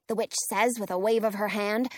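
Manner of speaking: ordinary delivery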